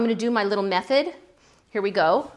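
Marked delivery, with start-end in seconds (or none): none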